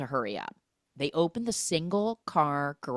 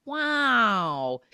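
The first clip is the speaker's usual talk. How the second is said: mock awe; 6 semitones higher, 6 dB louder than usual. Voicing smitten mother's reaction.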